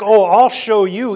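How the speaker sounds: mock defiance. An imagined rebel taunting the state, loud mock defiance. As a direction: throw down a challenge, hammed up. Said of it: "None of those stories like".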